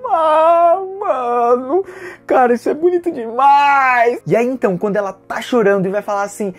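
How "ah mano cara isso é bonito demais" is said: high, loud cry of emotion, close to tears; moved to tears. Real, not acted. The vlogger reacting to the hug scene, high and choked up. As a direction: overcome by how beautiful it is. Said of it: "e eu, tipo".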